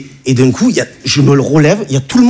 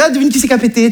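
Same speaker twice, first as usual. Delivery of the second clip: high, goofy voice; silly prank call; 8 semitones higher, faster than usual. Retold or imitated. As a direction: play the joker calling his girlfriend. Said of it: "je fais". (blagueur, voix aiguë et potache)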